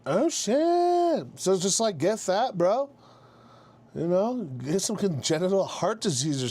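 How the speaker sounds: high excited exclamation. Real, not acted. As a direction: squeal of realisation. Excited reaction to loophole.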